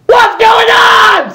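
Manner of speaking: very high, slow, shouted squeak; panicked squeak. Acted. Fluttershy squeaking in hammed-up panic at what is happening. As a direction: stretch out a shrill terrified squeak. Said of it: "squeaked Fluttershy, clearly panicked".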